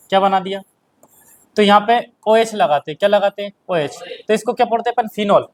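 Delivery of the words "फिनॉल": normal talking voice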